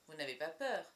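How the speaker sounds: mildly surprised question; a touch of astonishment; astonished. A narrator's reading. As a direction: ask it in mild disbelief. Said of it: "s'étonne le docteur".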